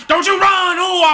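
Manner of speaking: high, loud yell of "Don't you run"; screamed warning. Real, not acted. Gamer screaming at the quarterback not to run, high yell.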